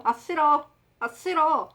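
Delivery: high, loud joking refusal; 장난스러운 거절. Retold or imitated. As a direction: refuse playfully, as a running joke. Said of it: "이제 장난으로". (장난치듯 높고 크게 거절)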